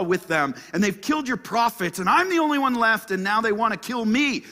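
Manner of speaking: high, loud protest; Elijah's complaint. Acted. Elijah complains of rejected covenant, loud lament. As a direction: plead your case loudly to God. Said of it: "look what Elijah says".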